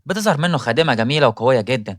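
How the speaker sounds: ordinary delivery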